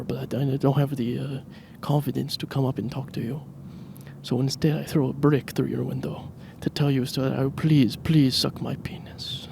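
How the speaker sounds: hushed, breathy mock Latin-lover accent; sexy hybrid accent. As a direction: flirt softly in a put-on Spanish-Italian-French accent, fading to a whisper. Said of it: "I'm doing it in that accent".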